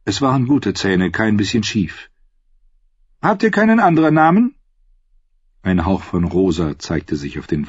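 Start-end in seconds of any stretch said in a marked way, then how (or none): 3.2-4.5 higher, quicker royal question; lightly voiced queen, pressing. A narrator's reading. Queen quizzes a singer about his name, questioning.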